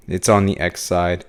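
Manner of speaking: ordinary talk, nothing marked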